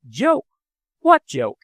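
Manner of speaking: Joke? jumps high and loud; puzzled, caught off guard; surprised question. A narrator's reading. Daughter baffled by father, surprised sharp question. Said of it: "asked Mary".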